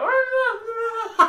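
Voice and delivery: loud, high-pitched wordless babble; high gibberish wail. Shown as a demonstration. Friend showing how they all spoke, high babble. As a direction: babble in a squealing mock-outraged voice. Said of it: "Just spoken like that".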